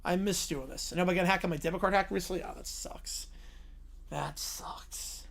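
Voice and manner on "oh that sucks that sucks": whisper. Real, not acted. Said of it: "whispered sympathy".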